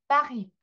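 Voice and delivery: French 파리 with a throaty r; French Paris. Shown as a demonstration. Teacher demonstrates French 파리 to students. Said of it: "이렇게 발음이 나겠죠".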